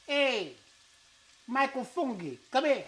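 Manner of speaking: playful shout to a pretend friend named Fungi; mock call out. Cook jokingly calls to an imaginary friend, playful shout. Calling out a made-up name.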